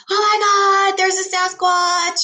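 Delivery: high, sing-song imitation of kids; imitating excited kids. Boy Scouts running back, voiced.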